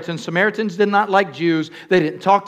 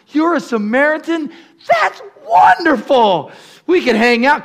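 mock delighted greeting; 8 semitones higher, 7 dB louder than usual.

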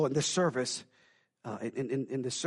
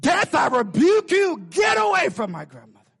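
put-on child rebuking death; 11 semitones higher, 11 dB louder than usual. Granddaughter commanding death away from her grandmother, high loud shout. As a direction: rebuke the devil at full force.

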